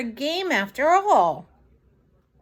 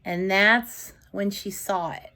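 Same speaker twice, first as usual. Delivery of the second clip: ordinary delivery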